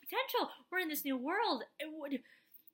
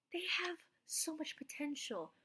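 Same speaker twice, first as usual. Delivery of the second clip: whisper; 10 dB quieter than usual. Real, not acted. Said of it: "whispered aside".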